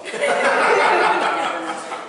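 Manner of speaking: loud real laugh falling away; burst of laughter. Real, not acted. Laughing at the joke.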